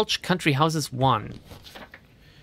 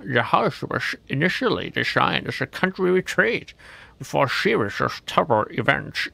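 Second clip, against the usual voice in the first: a hammed-up Scottish accent reading the lore, Sean Connery style; mock Scottish accent on a spooky history. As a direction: read the house history like Sean Connery narrating. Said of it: "It's Sean Connery".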